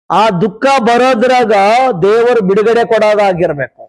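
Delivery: ordinary talk, nothing marked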